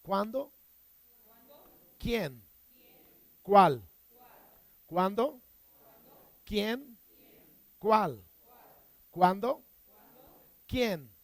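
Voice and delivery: cuándo, quién, cuál each punched with a pause between; a call and response drill, one word at a time; drilled question words. Shown as a demonstration. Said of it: "repite conmigo".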